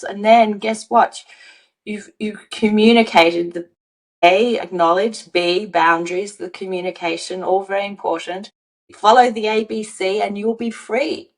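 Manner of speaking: ordinary talk, nothing marked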